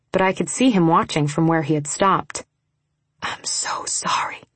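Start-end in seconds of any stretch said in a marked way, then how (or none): 3.2-4.4 slow whispered apology. A narrator's reading. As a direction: whisper it slowly, full of apology. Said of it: "Ryder whispered".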